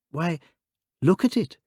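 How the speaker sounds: urgent high exclamation; crying out. A narrator's reading. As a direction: call out, pointing in alarm. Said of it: "cried Father Brown".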